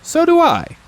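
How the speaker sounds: high, loud little bear voice; Pooh. A narrator's reading. Bear answers the boy's affection, high eager voice. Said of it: "said Pooh".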